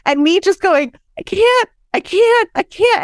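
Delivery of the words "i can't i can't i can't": higher, mildly desperate retold plea; desperate I can't. Mother in labor to her cheering team, high retold plea. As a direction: echo the panicked cry from labor, pitched up. Pleading during pushing stage.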